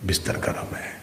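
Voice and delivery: very soft, hushed inner temptation; near-whispered lazy excuse. Acted. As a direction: whisper it like a thought luring you back to bed. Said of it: "اگلی آواز پھر آئی".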